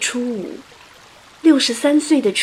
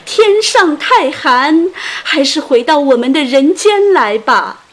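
sorrowful calling out; 4 semitones higher, 7 dB louder than usual. A narrator's reading. Mourners call Qu Yuan's soul back, sorrowful calling. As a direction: cry out pleading to the departed. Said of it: "他们悲伤地呼喊着".

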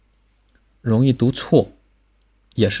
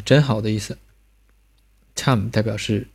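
ordinary talk

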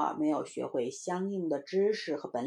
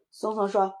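usual speaking voice

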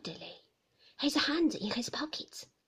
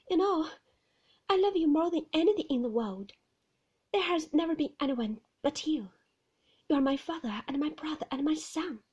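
put-on high, pleading young woman's voice, louder; anxious young wife; 5 semitones higher, 6 dB louder, faster than usual. Young wife pleading with Maxim about visitors, higher and louder. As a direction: plead to be understood, voice lifted high. Heroine declaring love.

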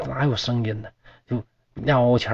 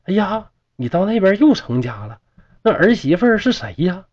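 put-on higher, astonished old mother's question; startled, doubtful; 6 semitones higher than usual. A narrator's reading. The mother asking her ghost son, raised startled disbelief. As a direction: sound amazed and unsure. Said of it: "这母亲就惊疑地问".

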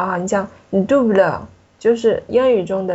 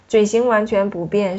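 normal talking voice

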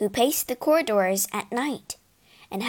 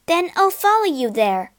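higher, louder firm vow; determined vow; 6 semitones higher, 3 dB louder than usual. Acted. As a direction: promise it with resolve. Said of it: "vowed Asta".

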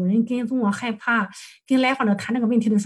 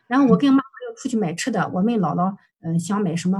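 ordinary talk

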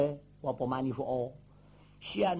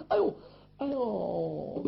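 groans of pain; slower than usual. Acted. Wounded man rises again, pain.

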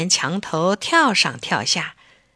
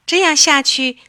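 usual speaking voice

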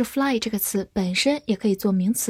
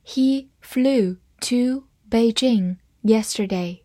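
slow, careful read-aloud; slower than usual. Shown as a demonstration. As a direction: read it slowly for the class. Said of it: "我们慢慢读一遍". (慢速朗读)

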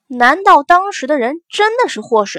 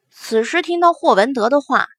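usual speaking voice

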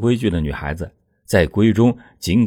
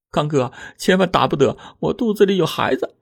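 higher woman's voice, begging urgently; frightened young wife; 9 semitones higher than usual. A narrator's reading. Wife begs husband not to hit her, high and urgent. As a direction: plead for the unborn child.

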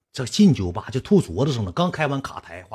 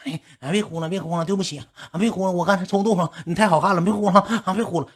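high, wheedling put-on apology; mock-soothing, pleading high voice; coaxing; 8 semitones higher than usual. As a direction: imitate a man sweet-talking someone out of crying. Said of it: "哄人家呢".

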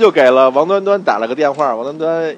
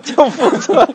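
laughing; 10 semitones higher, slower than usual. Real, not acted. Teasing repeat of the name.

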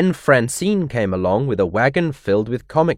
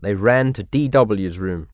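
ordinary delivery, nothing marked

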